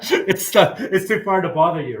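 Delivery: laughing through the words. Real, not acted. Laughing at own joke.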